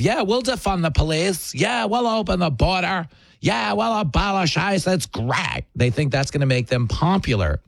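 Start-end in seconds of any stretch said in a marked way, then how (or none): 0.0-5.6 mock performative allyship. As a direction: parody gushing, self-satisfied activists. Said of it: "they think this performative allyship of".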